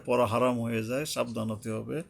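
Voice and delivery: normal talking voice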